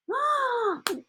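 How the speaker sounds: high breathy gasp of mock surprise. Teacher hams up surprise at the whale question with a gasp. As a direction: gasp as if shocked.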